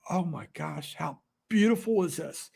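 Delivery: ordinary talk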